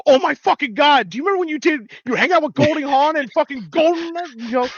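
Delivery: high, loud fanboy gushing; hammed-up starstruck squeal; overexcited fan. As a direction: gush like an overexcited superfan. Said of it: "the overexcited guy. I'm like".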